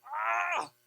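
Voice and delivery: a sudden high, loud cry of exasperation; frustrated scream. Retold or imitated. Preacher reliving being swamped by requests, fed-up scream. Overwhelmed dad at rehearsal.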